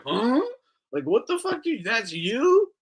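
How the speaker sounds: high, disbelieving; incredulous. Retold or imitated. [name] leaning back in disbelief at the host, high incredulous. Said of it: "a Friday look".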